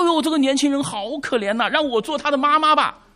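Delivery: high, pitying mock sigh of women. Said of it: "她说".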